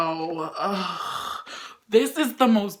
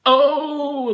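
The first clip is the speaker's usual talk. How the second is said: loud swoon; 10 dB louder, much slower than usual. Real, not acted.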